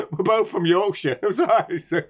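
giggling through the words, pitch up high; can barely get the words out for laughing; helpless giggles while apologising. Real, not acted. Laughing fit retelling ward story.

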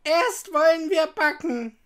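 high, loud witch voice; old witch. A narrator's reading. Witch giving Gretel her plan, loud witch voice. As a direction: announce it loudly as a high-pitched witch. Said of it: "sagte die Alte".

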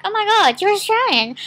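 high, loud, excited squeal; retelling her own thrilled outburst; starstruck gasp. Retold or imitated. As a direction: gush like you just met a celebrity. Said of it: "I was dumbfounded".

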